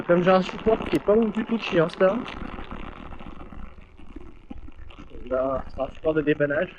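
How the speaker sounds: talking while riding over bumps; on a bumpy road. Real, not acted. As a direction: voice jolted by a rough road. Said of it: "vous devez entendre des bruits de tremblement".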